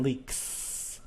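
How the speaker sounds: LEAKS ending in a drawn-out hissing S; unvoiced S ending. Shown as a demonstration. Teacher giving an example of unvoiced plurals, hissed S ending. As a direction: hold a voiceless s at the end of "leaks". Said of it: "you say the S sound".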